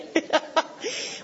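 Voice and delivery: breathy, high laughter; real laugh. Real, not acted. Speaker enjoys her friends' joke, real laughing. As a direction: laugh at your own punchline.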